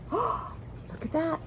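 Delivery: slow, breathless whisper of wonder; astonished whisper. A narrator's reading. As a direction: hush the kids and point in amazement. Surprised whisper to child listeners.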